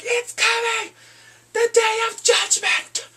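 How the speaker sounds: high, loud slow shout of glee; excited shout. Real, not acted. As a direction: yell it out thrilled. Viewer hyped at trial.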